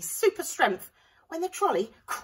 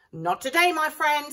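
higher, louder confident line; cool hero comeback; 8 semitones higher, 8 dB louder than usual. A narrator's reading. Sue Potato outsmarting the pea. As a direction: deliver it coolly. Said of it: "said Sue Potato".

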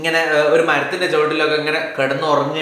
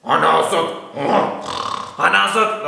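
lion answering; 2 semitones lower, much slower than usual. Acted. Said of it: "അപ്പൊ സിംഹം പറയാ".

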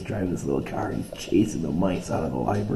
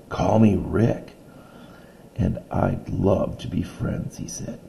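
reading a picture book aloud to a class; steady read-aloud storytelling voice; story narration; 6 dB louder than usual. A narrator's reading. Teacher reading a story to her class, read-aloud voice.

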